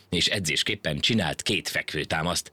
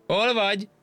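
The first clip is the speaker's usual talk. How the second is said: calling out. A narrator's reading. The monkey calls back from the far tree, shouting. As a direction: shout it across the distance. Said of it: "kiabált a másik fától".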